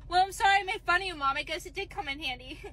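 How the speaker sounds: ordinary delivery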